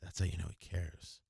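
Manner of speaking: low, soft mocking imitation of a caring man. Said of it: "speaking in a low voice like this".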